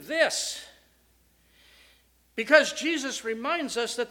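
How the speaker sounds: loud, soaring pulpit preaching; full-force preaching. Acted. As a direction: proclaim it to the back pews.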